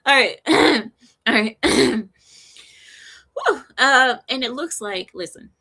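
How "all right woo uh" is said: ordinary talk, nothing marked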